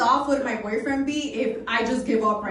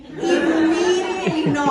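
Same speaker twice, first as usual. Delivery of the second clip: louder second no, laughing; louder emphatic no, half laughing; emphatic mock refusal; 4 semitones higher, 6 dB louder than usual. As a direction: repeat it harder and louder, cracking up. Refusing the mountain climb.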